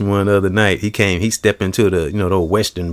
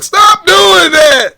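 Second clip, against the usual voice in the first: much higher, louder, slow squeal; furious cartoon yell; 8 dB louder, much slower than usual. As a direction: shriek it like a fuming cartoon cowboy. Imitating Yosemite Sam.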